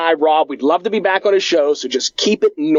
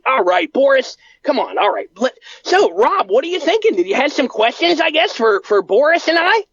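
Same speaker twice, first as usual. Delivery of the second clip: higher, eager nerdy fan; Bart voice; 7 semitones higher than usual. Acted. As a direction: talk like an eager grown man who loves toys. Plays Bart character.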